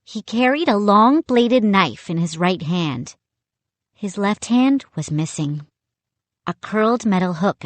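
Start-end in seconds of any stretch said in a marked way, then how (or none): none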